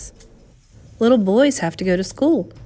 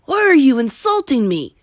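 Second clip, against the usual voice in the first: offended outburst; 10 semitones higher, 5 dB louder than usual. A narrator's reading.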